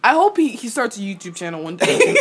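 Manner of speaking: normal talking voice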